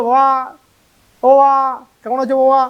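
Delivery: hollering baba like a buddy; calling father casually. Shown as a demonstration. Child casually calls out to his father like a friend, mock pally. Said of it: "আমি কি বাবাকে গিয়ে বলবো".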